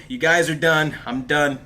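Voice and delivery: ordinary talk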